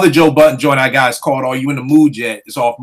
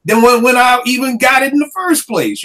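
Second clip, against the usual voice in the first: exasperated complaint; 7 semitones higher than usual. Retold or imitated. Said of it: "I just would be like".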